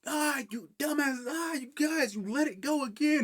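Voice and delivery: high, drawn-out hammed-up scolding; mock exasperated yelling. Anton pinned under friends who lost the hand, high mock yelling. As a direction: wail at them in strained mock outrage.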